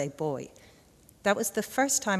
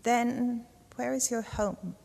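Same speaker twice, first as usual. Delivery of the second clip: higher, slow posh crow voice; 7 semitones higher, slower than usual. A narrator's reading. The old crow questions the slugs, slow and posh. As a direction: lean in and ask it slowly, haughtily. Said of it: "She's terribly posh".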